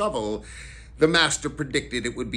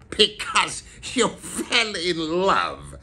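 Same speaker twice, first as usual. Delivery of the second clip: slow, raised, scornful echo; growled mockery; 7 semitones higher, 4 dB louder, slower than usual. A narrator's reading. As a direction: growl it back slowly as utterly ridiculous. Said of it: "growled Scrooge".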